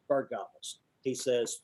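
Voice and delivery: ordinary talk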